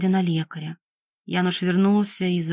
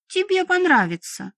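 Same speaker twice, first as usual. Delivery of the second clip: put-on high-pitched girlish insistence; explaining eagerly; 10 semitones higher than usual. A narrator's reading. Young vampire woman to the healer, high and insistent. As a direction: pitch it high, pressing her point. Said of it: "пояснила она".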